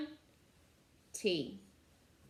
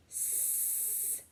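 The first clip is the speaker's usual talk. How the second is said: whispered; 18 dB quieter than usual. Shown as a demonstration. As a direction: whisper the word Sam.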